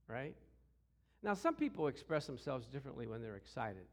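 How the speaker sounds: usual speaking voice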